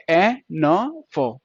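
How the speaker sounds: very slow ए... न... फो with gaps; sounded out. Shown as a demonstration. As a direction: spell out the sounds ए, न, फो one by one. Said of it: "second place long vowel है ओ". (ए न फो बहुत धीरे, अलग-अलग)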